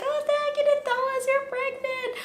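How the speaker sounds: very high gushing voice; imitated coworker. Coworkers hugging her about the pregnancy, very high. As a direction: gush it like an excited coworker. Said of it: "And they're like".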